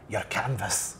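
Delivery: broad Scottish your canvas, rolled r; strong Scots accent. Shown as a demonstration. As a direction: lay the Scots on thick. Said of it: "Like, if you were getting really Scottish".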